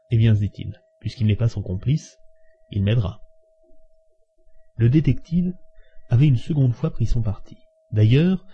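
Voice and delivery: usual speaking voice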